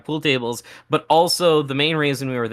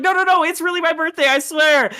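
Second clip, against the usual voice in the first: high, hurried protest; mock-panicked swearing it's true; retold frantic insisting; 4 dB louder than usual. Friend assuring guy about birthday, mock frantic.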